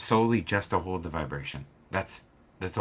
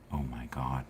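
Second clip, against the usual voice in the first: low, softer mock gasp of dismay; hushed dread; 5 semitones lower, 6 dB quieter than usual. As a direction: mutter it like you just got caught. Boss realises cameras exist.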